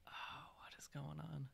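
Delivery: whispering. Real, not acted. Stream cutting out, confused aside.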